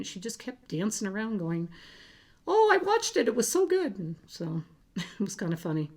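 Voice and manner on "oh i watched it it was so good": much higher, louder excited squeal; imitating a high-pitched voice. Youngest daughter telling everyone her mom is famous, in a high-pitched squeal. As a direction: mimic a thrilled little girl squealing. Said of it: "in that very high-pitched voice she was doing".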